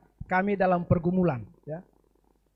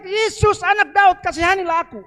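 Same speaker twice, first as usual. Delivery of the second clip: shouting plea; 10 semitones higher, 6 dB louder than usual. Acted. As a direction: shout it out, begging Jesus. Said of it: "Dia berteriak". (teriakan keras memohon kepada Yesus)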